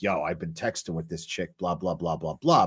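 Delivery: low, soft, lightly voiced bro bragging; bragging buddy. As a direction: mumble the brag like a bored bro. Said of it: "He's most likely bragging like".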